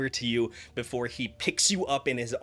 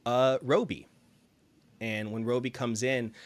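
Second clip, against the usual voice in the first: ordinary talk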